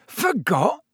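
high, weak incredulous echo; thin, shaken question; weak disbelief. A narrator's reading. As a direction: repeat it faintly in shock. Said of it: "said Stan weakly".

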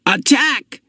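high-pitched attacking war cry; battle shout. A narrator's reading. Child starting a war game from behind the storehouse, shouting. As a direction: shout it as a charge into a mock battle. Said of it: "The shout came from".

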